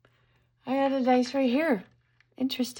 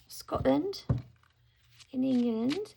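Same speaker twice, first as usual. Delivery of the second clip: English accent; 2 semitones higher, 6 dB quieter than usual. Shown as a demonstration. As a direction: put a British lilt on Scotland. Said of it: "I'm not good at my English accent".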